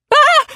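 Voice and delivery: high loud howling cry. A narrator's reading. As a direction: let out a piercing howl. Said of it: "Crystal howls".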